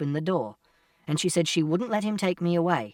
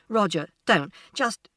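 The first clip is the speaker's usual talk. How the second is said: mildly raised, urgent cutting-in; shouted interjection; 4 semitones higher than usual. A narrator's reading. Mother trying to stop Roger, raised voice. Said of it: "And Mother shouted".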